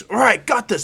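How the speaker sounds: loud, high burst of confidence; gung-ho. Acted. Dark Shadow taking on the fight, gung-ho shout. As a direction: burst out ready for action.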